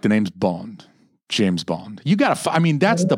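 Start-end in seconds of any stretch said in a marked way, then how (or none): 0.0-2.0 low, quiet, suave delivery; low Bond line. Retold or imitated. Quoting the film hero, low and suave. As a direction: purr it smooth and low like a spy. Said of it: "he's just like".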